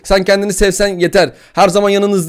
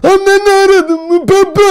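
put-on squeaky high anime girl voice, loud; high cute anime girl; 14 semitones higher, 6 dB louder, slower than usual. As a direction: imitate a cute anime character, shrill and bright. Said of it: "Anime sesi".

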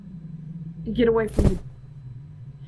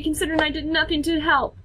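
normal talking voice

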